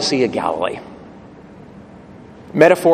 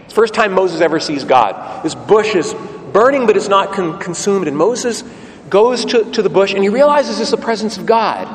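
normal talking voice

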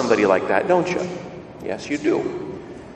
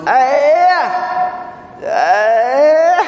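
high, loud imitation of a little boy yelling; rambunctious boy's yell; 11 semitones higher, 5 dB louder than usual. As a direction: mimic a small child yelling while swatting. Story's rambunctious boy swatting at priest.

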